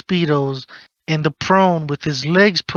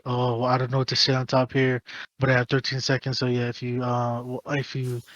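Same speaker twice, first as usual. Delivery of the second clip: mocking a lazy seller; 3 semitones lower, faster than usual. Mocking lazy e-pal sellers, a fading mumble. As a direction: mumble it like you can't be bothered. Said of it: "you're doing a perfect".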